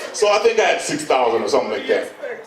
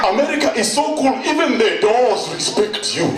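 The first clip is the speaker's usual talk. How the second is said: giddy excitement; 8 semitones higher than usual. Retold or imitated. Newcomer phoning his friend Peter in Africa, high giddy awe. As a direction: gush like you just saw a miracle.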